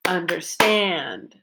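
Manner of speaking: slow "understand", long stressed last syllable; third-syllable stress. Shown as a demonstration. Teacher breaks down the focus word, stress on the end of "understand". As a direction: stretch the "stand" in "understand" and let it fall. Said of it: "third syllable that I stressed".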